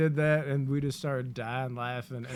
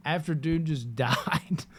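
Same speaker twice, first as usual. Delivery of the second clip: normal talking voice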